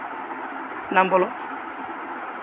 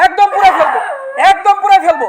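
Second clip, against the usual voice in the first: raised-pitch, forceful warning; stern threat; 7 semitones higher, 4 dB louder than usual. Real, not acted. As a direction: threaten the spirit, hammering the repeated words. Healer threatens the possessing spirit. (কড়া গলায় হুমকি)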